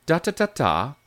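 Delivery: British lilt hummed on "da" syllables; sing-song "da-da-da-da" tune of British speech. Shown as a demonstration. Coach shows a key lilt, British intonation on nonsense syllables. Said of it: "a couple key lilts".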